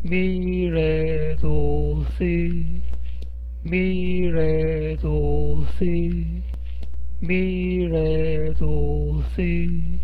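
ミレドシ very slow and stretched out; slow model reading. Shown as a demonstration. Teacher repeats the word slowly for the class. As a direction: spell out ミレドシ syllable by syllable. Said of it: "この文字が読めますか？".

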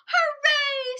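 high, loud squeal; excited cheer. Acted. Isabel cheers the yes, high loud squeal. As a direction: cheer at the top of her voice.

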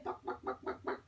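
soft, toneless rapid clucks; chicken clucking. Pretend. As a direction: cluck softly like a hen. Chicken sound repeated for child.

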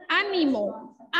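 "Ánimo" slowed and lifted high on Á; teacher stretching "Ánimo" with stress on Á; slow stress demo. Shown as a demonstration. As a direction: sing out the first syllable of "Ánimo". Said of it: "es una palabra esdrújula".

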